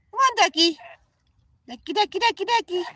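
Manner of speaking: high, lilting repeated calling; high sing-song call. Real, not acted. Owner calling a goose by pet name: high sing-song.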